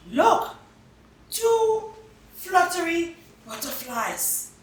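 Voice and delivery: high, delighted exclaim; high, delighted child's voice; excited spotting. Acted. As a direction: point it out with glee. Child spots butterflies.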